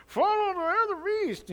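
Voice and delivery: high squeaky puppet voice; ventriloquist dummy voice. Retold or imitated. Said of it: "here's a dummy in their mouth".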